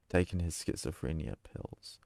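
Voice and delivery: ordinary delivery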